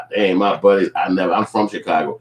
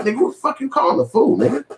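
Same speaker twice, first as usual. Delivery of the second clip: mock angry; faster than usual. Confronting his homie on the phone, high mock anger. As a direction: call him out, hurt. Angry call-back to friend.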